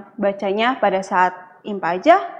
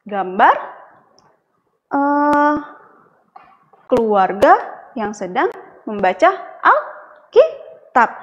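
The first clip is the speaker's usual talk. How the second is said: put-on reading to a toddler. Asks children about picture.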